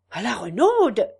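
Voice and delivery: loud surprised cry; a sudden surprised exclamation; exclaiming in surprise. Acted. Farmer's wife surprised by the name, crying out. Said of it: "s'écria la fermière".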